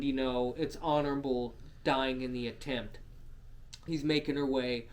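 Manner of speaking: ordinary talk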